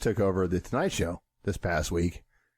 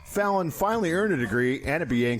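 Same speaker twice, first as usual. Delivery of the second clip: upbeat, higher news read; 4 semitones higher than usual. Acted. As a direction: read it like an entertainment anchor. Reads a mock biography segment.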